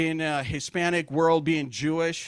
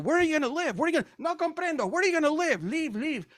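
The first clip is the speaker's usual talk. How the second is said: put-on Spanish accent; 9 semitones higher, faster than usual. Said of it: "I go".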